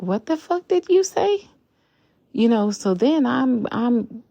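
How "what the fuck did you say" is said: high, loud, incredulous yell; outraged retort. Retold or imitated. Confronting the man who told her to get off his line, a shocked, angry yell. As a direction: snap back in shocked fury. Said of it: "And I'm like".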